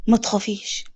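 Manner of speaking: low, soft reassurance; gently calm a scared child; reassuring. A narrator's reading. Man reassures a frightened child. (بصوت واطي مطمئن)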